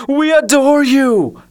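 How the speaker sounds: high, loud, slow adoring cry; cried out. Acted. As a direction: cry out your devotion. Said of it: "cried Benny".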